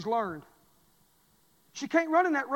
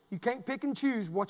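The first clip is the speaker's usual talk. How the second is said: normal talking voice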